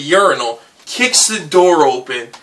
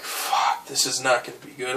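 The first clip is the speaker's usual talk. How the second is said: hushed panicked whisper; 3 semitones lower, 12 dB quieter than usual. Retold or imitated. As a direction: breathe it out under your breath. Panicked self-talk before vomiting.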